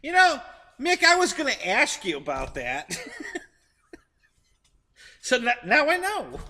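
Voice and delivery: laughing through the words; delighted laugh at the news. Real, not acted. Laughing over accent revelation.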